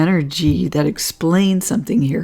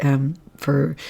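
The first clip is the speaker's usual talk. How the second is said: normal talking voice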